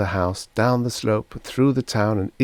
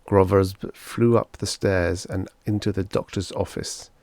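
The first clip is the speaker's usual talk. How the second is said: ordinary delivery, nothing marked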